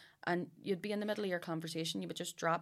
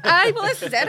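high, loud laughing agreement; 17 dB louder than usual. Real, not acted. Woman agreeing with joke about English husband, laughing. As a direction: agree through a burst of laughter.

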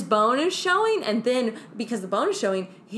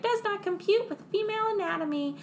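mock computer; 10 semitones higher, 4 dB quieter than usual. Computer refuses.

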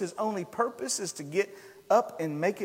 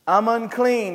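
louder, higher cry; yelled warning; 6 semitones higher, 10 dB louder than usual. Acted. Leper lifting his head and yelling, raised voice. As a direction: call it out so they hear. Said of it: "he yells".

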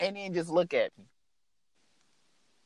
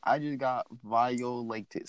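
low, slow and softer; deadpan repeat; 8 semitones lower, 6 dB quieter, slower than usual. Retold or imitated. Repeating his violation to a friend, low slow deadpan. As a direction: drop it low and stretch it out slowly.

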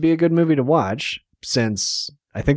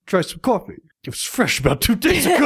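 imitated character voice; 11 semitones higher than usual. Quoting a character's line.

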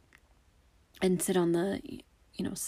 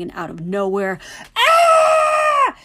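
a sudden high, loud jump-scare scream; mom's jump-scare shriek, much higher and louder; 11 dB louder, slower than usual. Retold or imitated. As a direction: burst out shrieking to scare the listener. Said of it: "I can't do my mom's scream justice".

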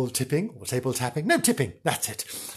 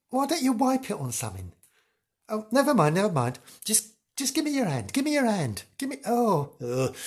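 ordinary delivery

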